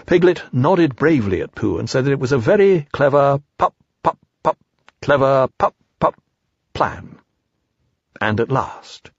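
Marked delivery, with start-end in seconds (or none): 3.6-7.2 repeated popped "pup" before "plan" finally gets out; halting stammer on "plan"; nervous stammer. Acted. Piglet's nervous stammer acted out.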